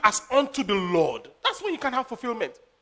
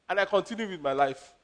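normal talking voice

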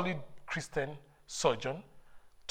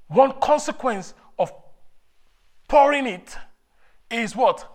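high, loud fervent call; preaching at full force; 7 semitones higher, 11 dB louder than usual. Acted. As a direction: call it to the crowd. Preacher calls out question.

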